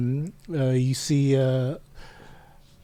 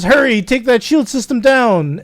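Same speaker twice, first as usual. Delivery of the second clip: urgent, raised-voice plea; loud, high cry for help, acted through tears; hammed-up desperate order; mock tearful; 8 semitones higher, 6 dB louder than usual. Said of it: "And through tears, he says".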